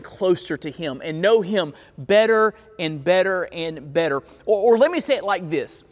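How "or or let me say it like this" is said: ordinary talk, nothing marked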